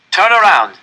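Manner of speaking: loud, high guard's command; harsh capo shouting an order; barked order. Acted. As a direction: bark it at the prisoners. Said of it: "our capo said at last".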